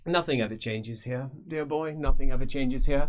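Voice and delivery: lightly voiced genial mage; wistfully amused; Feuerbach, wry. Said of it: "Feuerbach greets apprentice".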